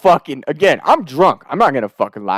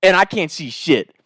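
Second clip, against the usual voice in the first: usual speaking voice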